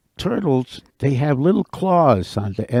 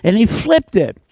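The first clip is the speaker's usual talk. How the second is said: high-pitched, urgent burst; alarmed, frantic telling; 12 semitones higher than usual. A narrator's reading. Beaver finds something on his tail, frantic. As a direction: keep it high and agitated throughout.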